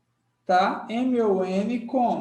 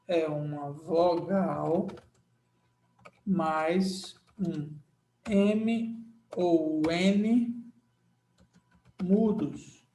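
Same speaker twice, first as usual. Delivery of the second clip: slow, each part spaced out, M ou N dragged; slow recap; 2 semitones lower, much slower than usual. Shown as a demonstration. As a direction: spell the rule out slowly. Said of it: "O que é que é um dígrafo vocálico mesmo". (bem devagar, destacando cada parte)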